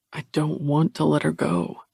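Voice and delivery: plain reading voice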